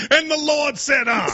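mock a fiery Sunday sermon. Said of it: "That's what you sound like".

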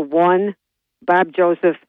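ordinary talk

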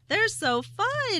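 high, slow, gushing delight; drawn-out squeal of delight at the electronic ponies. Real, not acted. Coworker gushing about the electronic ponies, drawn-out and high.

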